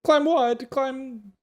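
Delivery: high, loud, bewildered animal voice; confused camel voice. Acted. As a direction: squeak it out like a lost camel.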